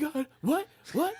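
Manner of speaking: high confused "what"; imitating Little B, baffled; startled kid. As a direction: play the clueless teen. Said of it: "He's like".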